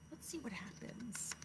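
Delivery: soft secretive whisper; suspense whisper. Real, not acted. Teacher whispering to build suspense before reading on. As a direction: hush them before the page turns. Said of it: "teacher building suspense".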